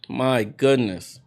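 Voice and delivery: brisk live game call, names punched; play-by-play. Acted. Commentator calling the game for viewers, in lively play-by-play.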